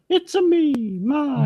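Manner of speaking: very high-pitched cartoon Italian voice; Mario. Asking his friend how the doctor sounds, high Mario impression. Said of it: "he doesn't sound like that".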